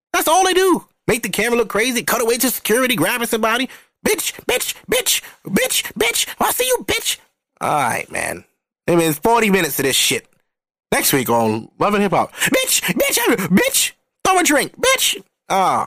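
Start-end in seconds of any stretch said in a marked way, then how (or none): 4.1-7.2 much higher squawking repeated insults; imitating a cast member mid-brawl, much higher; mock reality-show catfight. Mimicking Love and Hip Hop fight. 12.3-15.2 much higher squawking repeated insults; imitating a cast member mid-brawl, much higher; mock reality-show catfight. Mimicking next-week Love and Hip Hop preview.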